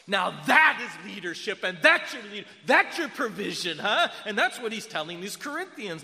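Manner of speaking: high, loud, impassioned sermon voice; full-force preaching. Real, not acted. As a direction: build to a shouted climax.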